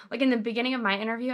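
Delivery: ordinary talk, nothing marked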